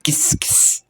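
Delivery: "किस" whispered, voiceless. Shown as a demonstration. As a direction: breathe the X sound "किस". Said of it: "x का जो basically sound होता है". (फुसफुसाकर "किस")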